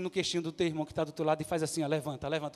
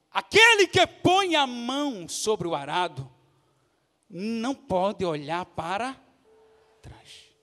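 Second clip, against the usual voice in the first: loud, slow preaching with stretched words; declaimed scripture; 3 semitones higher, 9 dB louder, much slower than usual. Real, not acted. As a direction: proclaim the verse, drawing it out. (versículo proclamado em voz alta)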